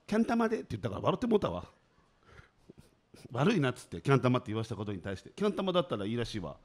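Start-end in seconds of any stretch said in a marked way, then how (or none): 0.1-0.6 high-pitched mock girl's voice; lightly put-on girlish delivery; imitating a girl. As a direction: quote the girl in a raised pitch. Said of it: "女の子が".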